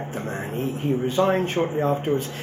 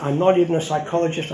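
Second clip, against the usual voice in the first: normal talking voice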